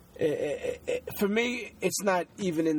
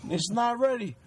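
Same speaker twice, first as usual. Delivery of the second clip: Jake LaMotta quote; 6 semitones higher than usual. Retold or imitated. Quoting Raging Bull steak scene.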